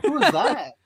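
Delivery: baffled, looking around for the speaker; surprised. Retold or imitated. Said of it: "I was like".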